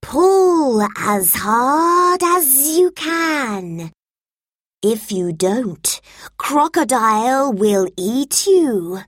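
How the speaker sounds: high, slow, pleading snake; lightly voiced urgent snake. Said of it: "he said".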